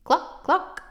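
high hen voice, cluck cluck. A narrator's reading.

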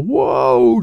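high, loud cry of alarm; scream. Acted. Dad as his car lurches off, screaming. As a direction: draw it out in a high yell. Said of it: "Dad screamed".

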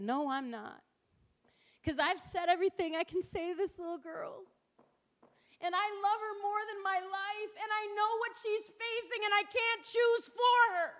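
mom choking up while letting her daughter go; tearful mother. Retold or imitated. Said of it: "I lost it".

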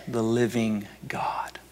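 slow reverent whisper; hushed, slow, full of wonder; awed whisper. Acted. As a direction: breathe it out in awe. Awe at meeting God.

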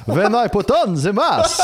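loud, slow, hammed-up Romanian accent; over-the-top fake Romanian, drawn out; mock Romanian accent. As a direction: ham it up high in a cartoonish Romanian accent. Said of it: "that was Romanian".

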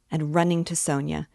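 ordinary delivery

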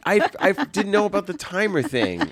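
high, loud, flustered laughing; flustered surprise. Real, not acted. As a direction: sputter in embarrassment. Caught off guard by timer.

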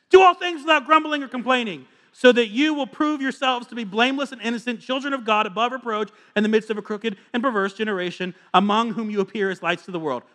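forceful pulpit reading; preached scripture. Acted. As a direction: declaim the verse to the congregation.

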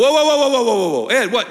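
loud, high mock alarm; shocked objection. Church members balking at God as opponent, mock alarm. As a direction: play the startled listener protesting. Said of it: "some of you are like".

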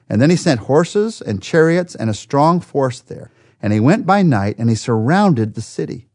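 ordinary delivery

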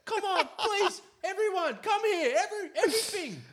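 very high, frantic mock pleading; desperate eager Australia. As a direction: beg everyone in, squealing and hammed-up. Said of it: "Australia be like".

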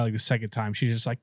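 ordinary talk

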